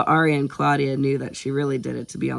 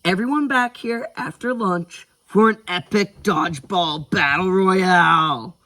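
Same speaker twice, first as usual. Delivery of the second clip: loud, building announcer proclamation; big, dramatic event announcer call; epic announcement; 5 semitones higher, 5 dB louder than usual. A narrator's reading. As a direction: hype it up, stretching the last words. Cam announces an epic match.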